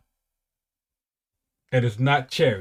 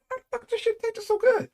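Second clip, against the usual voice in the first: put-on amazed little kid; 4 dB quieter than usual. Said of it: "I was like".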